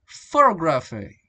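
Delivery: "photography" set apart and stressed; noun stress shift. Shown as a demonstration. Teacher showing the derived noun, "photography" with shifted stress. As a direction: give "photography" its own stress pattern. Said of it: "go to the noun".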